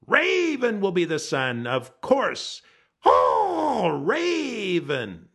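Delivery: a vain bird crowing his own name; boastful Raven. A narrator's reading. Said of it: "he answered".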